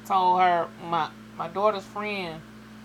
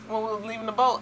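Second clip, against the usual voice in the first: usual speaking voice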